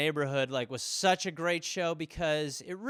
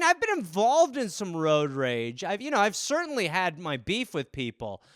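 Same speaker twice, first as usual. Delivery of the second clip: ordinary talk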